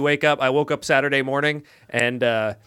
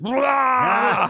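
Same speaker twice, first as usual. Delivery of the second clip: a sudden, higher retching noise; mock vomiting noise; 6 semitones higher than usual. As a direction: imitate a kid throwing up on you. Imitating son vomiting at him.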